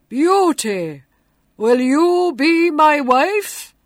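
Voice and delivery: loud, booming beast voice; grotesque monster voice. A narrator's reading. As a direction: boom it out like a monster. The monster speaks.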